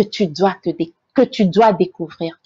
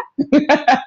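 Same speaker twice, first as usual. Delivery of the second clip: real laugh, pitched up; amused laugh; 4 semitones higher than usual. Real, not acted. Joking about hiding behind Ivorian French, amused laugh.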